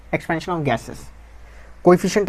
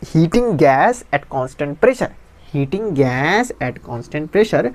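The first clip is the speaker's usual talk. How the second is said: slow dictation; much slower than usual. Shown as a demonstration. Teacher dictating a heading to students, very slow. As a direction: dictate it word by word, very slowly.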